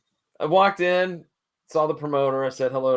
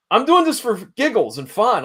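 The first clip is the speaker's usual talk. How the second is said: ordinary delivery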